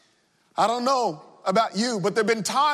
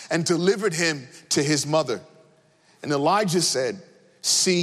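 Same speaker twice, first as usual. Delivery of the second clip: lower, measured Bible reading voice; scripture reading; 4 semitones lower than usual. Acted. Reads scripture aloud from Kings.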